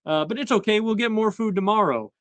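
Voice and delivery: high-pitched put-on character voice; Tangletongue. Underling explains to the annoyed king about food, high put-on voice. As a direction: lightly imitate a hapless underling, pitched well up. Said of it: "he is told by Tangletongue".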